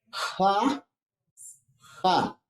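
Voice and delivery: "ja" with strong rasping friction at the start; fricative demo. Shown as a demonstration. Teacher showing there is no closure, drawn-out friction. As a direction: push air audibly through the J of "ja". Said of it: "Ja, hay una fricción".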